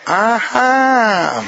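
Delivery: long drawn-out, serious "ajá". Shown as a demonstration. Wife answering her husband, serious. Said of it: "pero seria".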